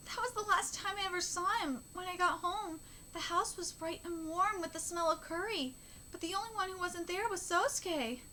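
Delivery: understated mournful manga monologue; grieving mother's recollection. Acted. Reads bereaved mother's account.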